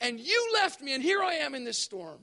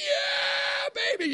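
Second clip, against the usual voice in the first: much higher, excited cry of delight; gleeful yell; 13 semitones higher, much slower than usual. Acted. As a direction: whoop at the sight of the donut light. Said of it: "you're like".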